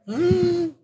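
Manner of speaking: high musing mm; pondering hum. Retold or imitated. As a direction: hum like it's a tempting idea. Mulling over the date's offer.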